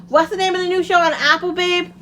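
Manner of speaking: loud, high-pitched question to babe; calling out. Real, not acted. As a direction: call over to someone across the room. Calls to partner off mic.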